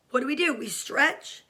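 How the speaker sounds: normal talking voice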